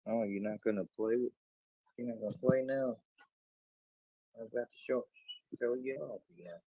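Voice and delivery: soft, high and playful, teasing the dog. Real, not acted. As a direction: coax the dog to play, softly. Playing with the dog.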